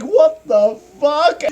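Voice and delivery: high, slow what the fuck; shocked disbelief. Retold or imitated. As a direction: react in total shock. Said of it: "I'm like".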